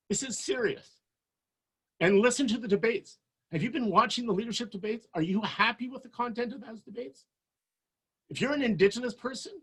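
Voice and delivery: usual speaking voice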